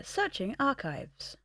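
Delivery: slow, stiff robot readout; lightly voiced machine, word by word; jerky animatronic voice. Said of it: "animatronic side sort of takes over".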